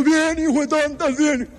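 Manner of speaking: a hint of boys celebrating a goal. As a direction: whoop it like a teenage boy after a goal. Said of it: "Y los niños".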